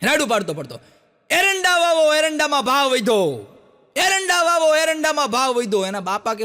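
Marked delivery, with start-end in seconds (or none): 1.3-5.8 calling out. Retold or imitated. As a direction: shout it out like a street announcement. Son calling out through village.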